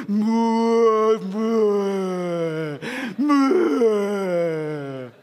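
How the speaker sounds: loud mock father sputtering; a father reacting to the family fight, mock yell; gruff dad. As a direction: splutter like an exasperated dad. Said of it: "아빠가 뭐라고 얘기를 합니까?".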